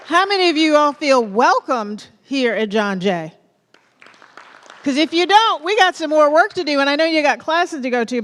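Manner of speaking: loud, high pep-rally questions; rallying crowd call. Real, not acted. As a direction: push the energy up to the back row.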